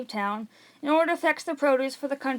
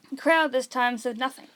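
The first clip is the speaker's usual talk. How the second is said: ordinary delivery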